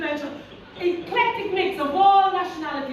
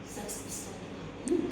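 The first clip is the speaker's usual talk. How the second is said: slow, much softer and lower, teasing; sultry; 7 semitones lower, 12 dB quieter, slower than usual. Shown as a demonstration. Inner voice flirts with yourself, low and slow sultry. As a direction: purr it slowly to yourself. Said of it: "It might say".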